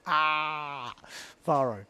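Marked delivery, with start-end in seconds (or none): 1.4-1.8 Varo with the stress on VA, falling; stress on first syllable. Shown as a demonstration. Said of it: "Put the emphasis on the first syllable".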